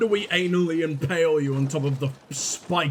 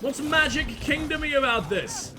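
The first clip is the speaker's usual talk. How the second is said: ordinary talk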